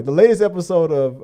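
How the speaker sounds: normal talking voice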